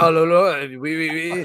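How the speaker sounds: hammed-up French accent, starting loud; stupid French accent. Mimicking a whole night of party role-play, stupid French accent. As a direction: babble like a cartoon French person. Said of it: "doing this stupid French accent".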